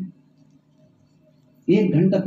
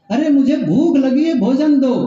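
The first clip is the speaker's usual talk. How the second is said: raised, higher-pitched demand for food; louder retold call; 6 semitones higher, 3 dB louder than usual. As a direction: call it out a bit more forcefully. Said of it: "थोड़ा loudly कहा". (थोड़ा ज़ोर से, ऊँचे सुर में)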